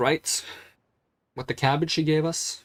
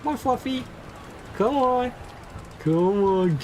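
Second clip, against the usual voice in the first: high sing-song pet coaxing; 11 semitones higher than usual. Acted. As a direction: call the pet back sweetly. Coaxing pet crab back.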